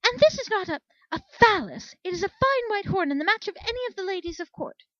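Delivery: haughty unicorn mare shrilling in offended fury; indignant outburst. A narrator's reading. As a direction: shriek your wounded pride at the soldier. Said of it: "winding herself up further".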